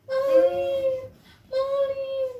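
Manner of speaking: high, loud mother's cry; mother calling. Acted. Mother holding her son, calling high. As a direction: call his name again, high.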